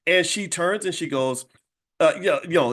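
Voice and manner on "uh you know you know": ordinary talk, nothing marked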